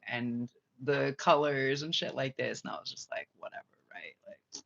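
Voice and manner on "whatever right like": softer, breathy brush-off; dismissive whisper. Retold or imitated. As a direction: whisper it like you couldn't care less. Dismissing mockers.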